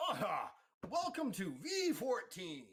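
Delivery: host NPC welcoming the player to V fourteen; NPC host welcome. Acted. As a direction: greet the newcomer grandly then lean in. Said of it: "and he goes".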